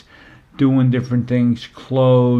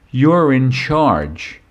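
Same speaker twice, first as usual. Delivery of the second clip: "You're in charge" slowly, with weight on "You're"; drawn-out, firm stress on "You're"; emphatic statement; 2 semitones higher, 4 dB louder, much slower than usual. Retold or imitated. Daughter tells dad "You're in charge", slow and emphatic.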